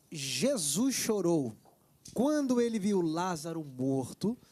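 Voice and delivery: reading the viewer's question slowly and deliberately. Shown as a demonstration. Host reads a viewer's question on air, slow and deliberate. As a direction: lay out each word of the question slowly. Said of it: "que é assim".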